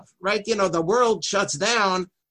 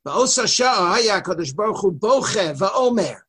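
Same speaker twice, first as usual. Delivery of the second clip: usual speaking voice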